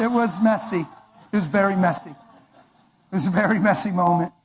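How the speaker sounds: usual speaking voice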